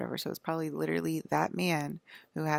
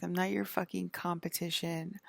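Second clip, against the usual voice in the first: ordinary talk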